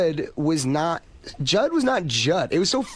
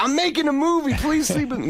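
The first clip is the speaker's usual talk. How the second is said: mock pleading; 9 semitones higher than usual. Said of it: "you're like".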